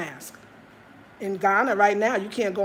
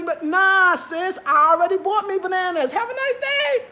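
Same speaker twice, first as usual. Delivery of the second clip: higher, louder, sassy goodbye; cheeky brush-off; 8 semitones higher, 5 dB louder than usual. Retold or imitated. Telling the auntie she already has bananas and bidding her good day, sassy.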